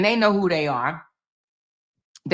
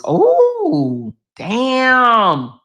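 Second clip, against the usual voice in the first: surprised damn; 7 semitones higher than usual. Real, not acted. Reacting to freezing rain news.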